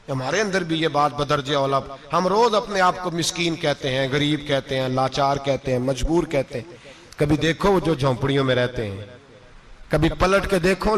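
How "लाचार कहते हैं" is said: ordinary delivery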